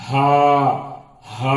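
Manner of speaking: "ہا" with air circling out of the chest; sustained model "ہا"; airy chest h. Shown as a demonstration. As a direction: hold "ہا" long on the breath. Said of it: "ہوا گھوم کر نکلتی ہے".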